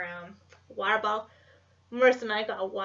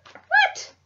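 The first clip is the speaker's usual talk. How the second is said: a sudden squeaky, much higher and louder yelp; surprised; 9 dB louder than usual. Real, not acted. Spotting something new in the guidebook, a high startled yelp. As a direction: yelp in shock, voice jumping way up.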